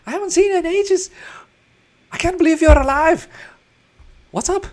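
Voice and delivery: very high, loud, overjoyed squeal; excited gnome Tanston. Acted. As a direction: gush like a tiny gnome seeing old friends. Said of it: "and he's like".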